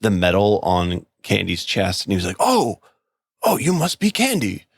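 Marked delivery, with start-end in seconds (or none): 2.4-4.6 high-pitched, imitated old officer's recognition; older military guy. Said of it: "this older military guy comes in".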